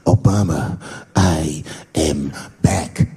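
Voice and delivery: low, very slow menace to camera; slow, ominous imitation of a terrorist; ISIS militant. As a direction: intone it low, one word at a time. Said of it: "He looked right in the camera and said".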